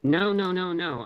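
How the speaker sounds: understated grief, refusing to believe; choked with emotion. A narrator's reading. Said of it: "I murmur, my voice choked with emotion".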